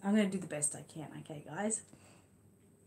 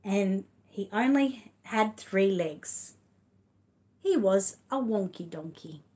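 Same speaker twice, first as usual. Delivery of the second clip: big, louder read-aloud voice, stretched slowly; reading to children; 2 semitones higher, 6 dB louder, slower than usual. Acted. Reading The Wonky Donkey to her son and viewers, lively read-aloud. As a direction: tell it like a lively picture book for little ones. Reads picture book aloud.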